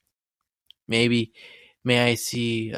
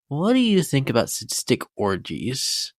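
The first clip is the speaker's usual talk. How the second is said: usual speaking voice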